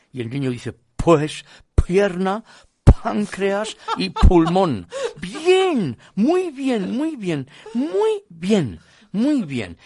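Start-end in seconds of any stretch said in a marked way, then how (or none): 1.0-4.9 loud, very slow, higher pupil voice; schoolboy reciting. Acted. Pupil answers the teacher with P words, slow recitation. 5.2-9.8 high, loud, swooping congratulations; gushing teacher praise. Acted. Teacher applauds pupil's answer, gushing excitedly.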